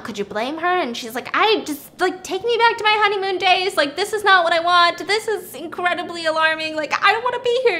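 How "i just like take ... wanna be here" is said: high, loud mock panic; retold freak-out. Woman wanting her honeymoon days back, imitated freaking out. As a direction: protest in an imitated panic, pitch climbing. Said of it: "she starts freaking out".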